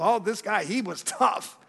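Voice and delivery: lightly voiced admiring crowd, slow at the end; a touch of mock awe. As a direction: drawl out the admiration a little mockingly. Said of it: "A lot of people".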